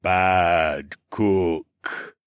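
two long, drawn-out words; slow slothman drone. A narrator's reading. Slothman pointing at himself, slow self-mocking drone. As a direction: stretch each word like a sloth. Slothman droning, continues after narration.

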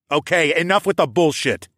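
impatient interrogator taking over; cutting in. A narrator's reading. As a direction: cut in firmly on their bickering. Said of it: "I cut in".

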